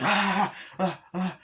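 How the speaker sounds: high, short "ah"s; hammed-up startled cries; imitated frightened gasps. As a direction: gasp out in mock alarm.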